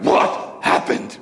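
angry gorilla father's rough, drawn-out bellow; Kerchak. Retold or imitated. Big gorilla showing up to his family, imitated angry growl. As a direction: growl it out slowly like a riled gorilla. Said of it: "with that exact same tone".

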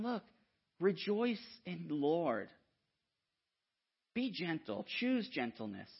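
soft, slow, gentle urging; a hint of tenderness. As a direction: coax them kindly toward joy. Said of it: "he's saying".

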